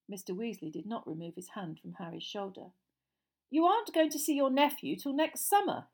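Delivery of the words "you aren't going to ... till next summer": higher, louder, mildly affronted; mild indignation. A narrator's reading. Mister Weasley to Uncle Vernon about his nephew, mildly indignant. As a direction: protest with polite disbelief. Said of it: "in mild indignation".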